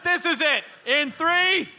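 sustained high-pitched heckler holler; playful declaration in a high, projected voice; mock shouting out. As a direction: keep calling out high, as if unable to stop. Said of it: "seems like I'm shouting out right now".

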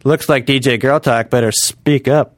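slow, dry detective one-liner; Jerry Orbach. Retold or imitated. TV detective making a pun at the crime scene, slow dry quip. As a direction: deliver it slow like a cop's closing quip. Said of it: "And Jerry Orbach's like".

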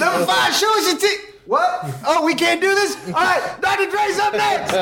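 high emcee holler, cut off mid-call; shout, stop confused, then announce briskly; interrupted hype man. Retold or imitated. Emcee replayed getting called off stage, thrown off. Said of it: "And he goes".

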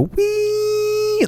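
very high, slow whistling wee; imitated ear ringing. Shown as a demonstration. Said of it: "my ears go".

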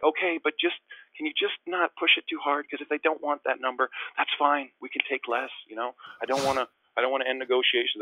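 high, pressing plea; anxious pleading. Retold or imitated. Player begging agents not to push too hard, pleading.

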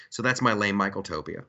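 usual speaking voice

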